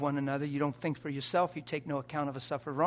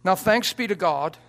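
loud, slow, solemn reading; scripture reading; 3 semitones higher, 8 dB louder than usual. Acted. Preacher reading Second Corinthians to congregation, solemn. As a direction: proclaim the verse slowly.

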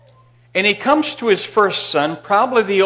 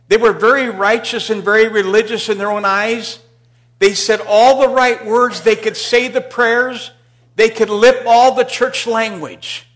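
building preaching; 6 semitones higher, 9 dB louder than usual. Real, not acted. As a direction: drive it home to the congregation. Preaching climax on hypocrisy.

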